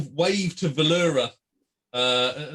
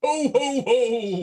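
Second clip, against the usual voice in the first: high, booming ho-ho-ho; Santa laugh; 9 semitones higher, 4 dB louder, much slower than usual. Acted. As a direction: bellow a jolly Santa greeting. Fungo greets friend as Santa.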